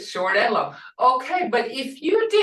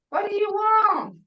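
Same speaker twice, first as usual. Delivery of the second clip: normal talking voice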